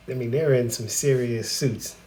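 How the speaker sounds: ordinary delivery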